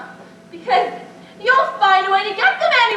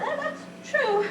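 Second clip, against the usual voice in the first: teary; 7 semitones higher, 7 dB quieter than usual. Acted. Aunt moved to tears.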